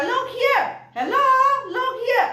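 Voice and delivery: high, projected call. Shown as a demonstration. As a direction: grab everyone's attention. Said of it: "karena suara kamu". (panggilan tinggi dan lantang)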